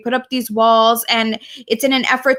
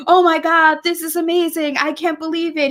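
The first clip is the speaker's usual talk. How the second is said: excited; 5 semitones higher than usual. Retold or imitated. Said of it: "he was super excited".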